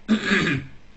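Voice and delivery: pointed throat clear mid-sentence. Acted. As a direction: clear the throat, then carry on.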